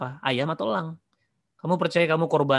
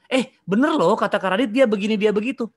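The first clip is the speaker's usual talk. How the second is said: eager hearsay; 6 semitones higher, 5 dB louder than usual. Acted. Acts out a listener passing on gossip.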